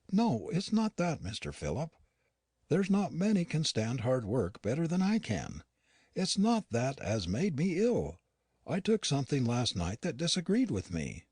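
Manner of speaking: lightly voiced housekeeper, uneasy and unwell; Yorkshire housekeeper Nancy. A narrator's reading. Nancy insists it's not overwork.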